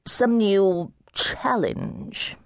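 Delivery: put-on slow, slurred tipsy young gentleman, groping for words; drunken slur. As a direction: drag it out like a man far gone in drink. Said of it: "You are three sheets to the wind".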